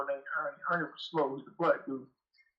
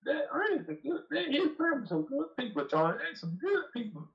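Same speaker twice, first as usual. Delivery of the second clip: high, sweet-talking cover-up voice; high mock-innocent boss covering his slip; imitating the boss; 10 semitones higher than usual. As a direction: mimic him smoothing it over, pitched way up. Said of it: "talking about".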